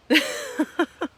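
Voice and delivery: short rueful laugh at herself; self-mocking laugh. Real, not acted. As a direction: laugh it off lightly. Laughs at her own fitness.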